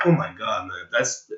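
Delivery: ordinary talk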